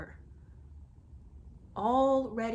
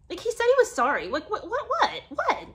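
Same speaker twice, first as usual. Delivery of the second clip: high, laughing disbelief; what what what, laughing in outrage; incredulous; 10 semitones higher, 4 dB louder than usual. Real, not acted. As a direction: squeal of indignant disbelief. Outraged at his date's text.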